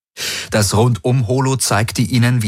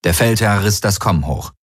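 ordinary delivery, nothing marked

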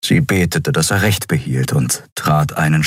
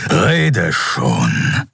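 growling beast. A narrator's reading. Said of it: "grollte Keru".

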